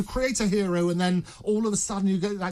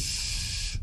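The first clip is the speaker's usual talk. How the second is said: a hushed unvoiced shh; shushing whisper; 5 dB quieter than usual. Informant hushing the journalist, whispered shh. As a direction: hush him with a breathy shh. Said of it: "said Sore Throat".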